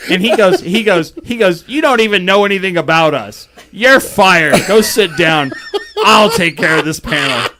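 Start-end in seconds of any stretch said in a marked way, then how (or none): 1.7-7.5 louder, higher, emphatic mock telling-off; hammed-up takeover of the stage; imitated angry dismissal. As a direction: fire someone loudly, as a retold rant. Retelling guest firing him onstage.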